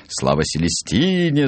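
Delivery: high-pitched rallying cry; crowd's cheer. A narrator's reading. Whole clinic takes up the cheer, high exclaimed shout. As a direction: chant it up high, stretching the name. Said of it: "закричала вся клиника".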